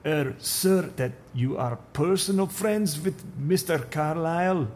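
put-on probing in a Russian accent. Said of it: "Russian accent".

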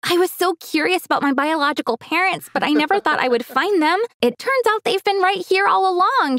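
put-on high, delighted young woman's voice; happy, excited girl. A narrator's reading. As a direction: share happy amazement, pitched well up. Ella was very happy.

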